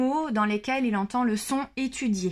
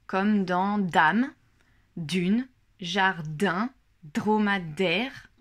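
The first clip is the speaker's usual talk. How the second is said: each word said slowly and clearly; slow example words; 2 semitones lower, much slower than usual. Shown as a demonstration. Teacher giving example words to parents, slow and clear. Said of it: "nous sommes sur le son D".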